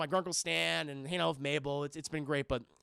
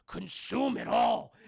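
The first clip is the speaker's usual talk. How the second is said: slow, higher mock command; commanding mascot; 4 semitones higher, 4 dB louder, slower than usual. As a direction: order it slowly like a sinister mascot. Mascot commanding Mabel in her mind.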